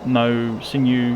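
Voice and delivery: usual speaking voice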